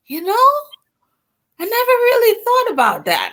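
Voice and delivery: high-pitched mom voice, laughing; mom's amused reply; quoting mom. Retold or imitated. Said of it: "How did you pick dad?".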